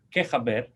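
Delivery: "qué jaber" with the j of "jaber" said as a Spanish jota; Ladino greeting. Shown as a demonstration. Teacher giving the Ladino how-are-you to class, jota in "jaber". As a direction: say "qué jaber", xaber with a throaty j.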